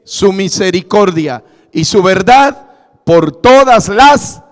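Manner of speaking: emphatic pulpit delivery, often slow; preaching. Acted. Pastor teaching families from the pulpit, emphatic and deliberate. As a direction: lay it out firmly for the congregation.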